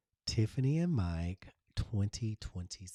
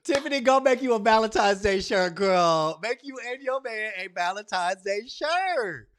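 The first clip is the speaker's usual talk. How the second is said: high, loud hyped-up call; excited hollering; 13 semitones higher, 13 dB louder than usual. Real, not acted. Hyped call to make a shirt.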